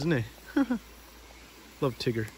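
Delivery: usual speaking voice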